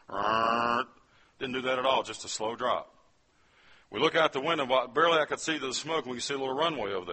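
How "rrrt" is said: imitated diving plane noise; movie plane dive noise. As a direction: buzz it like a plane nosediving in a film. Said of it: "it starts going this".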